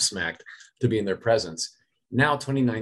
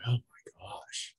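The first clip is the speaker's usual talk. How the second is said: slow, much softer whisper; whispered oh my gosh; 9 dB quieter, slower than usual. Real, not acted. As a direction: breathe it out, caught off guard. Stunned by the interview question.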